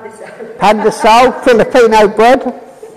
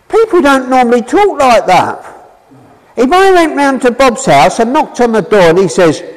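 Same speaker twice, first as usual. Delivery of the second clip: ordinary delivery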